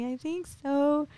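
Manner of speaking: high, drawn-out, tentative reply; retold tearfulness. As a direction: imitate answering through tears, slowly. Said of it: "I started crying".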